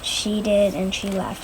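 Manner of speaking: ordinary delivery, nothing marked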